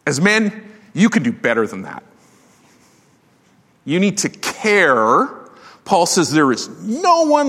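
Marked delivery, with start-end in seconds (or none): 3.8-5.3 each word set down slowly; slow, pressing exhortation; slow emphatic plea. Real, not acted. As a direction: drag it out to make them hear it. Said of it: "slow emphatic exhortation".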